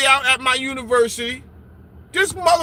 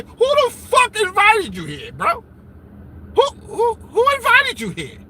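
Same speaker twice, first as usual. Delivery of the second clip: higher, mock-outraged demand; mock indignant; 6 semitones higher than usual. Host scolding an uninvited guest, mock indignant. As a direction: play the offended party host.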